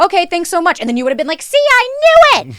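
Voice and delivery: high, loud I-told-you-so; mock gotcha. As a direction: crow triumphantly. Mocking gotcha reaction.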